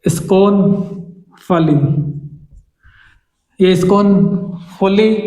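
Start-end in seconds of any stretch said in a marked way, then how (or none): 3.6-5.2 scornfully said slowly, ending in फली not फुली; pronunciation note. Shown as a demonstration. Teacher shows how to say scornfully, slow, फली ending. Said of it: "इसका pronunciation फुली नहीं होता, फली होता है".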